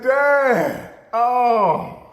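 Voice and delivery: high, loud cry of dismay; stunned boy. Retold or imitated. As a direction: let it out high like a shocked kid. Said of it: "I said".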